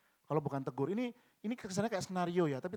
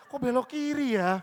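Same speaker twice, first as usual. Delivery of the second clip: higher, louder puzzled wondering; puzzled question; 8 semitones higher, 9 dB louder than usual. Retold or imitated. Driver baffled by the map's turn, higher and puzzled. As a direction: sound baffled at the directions.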